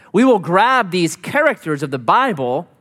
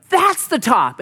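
higher and eager; mock excitement; 8 semitones higher, slower than usual. Climber spotting a false summit, eager. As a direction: announce the top with sudden hope. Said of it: "You're like".

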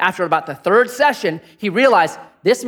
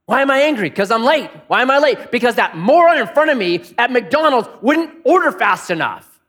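higher, louder, impatient grumbling; exasperated rant; 8 semitones higher, 6 dB louder than usual. Acted. Preacher acting out anger at a McDonald's line, exasperated. Acted angry inner rant.

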